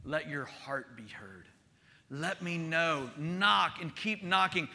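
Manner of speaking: hushed, slow, very soft invitation; tender voice of Jesus. Acted. Jesus inviting the desperate to pray, hushed and slow. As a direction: speak gently as Jesus inviting. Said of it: "He's saying".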